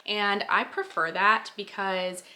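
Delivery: ordinary talk, nothing marked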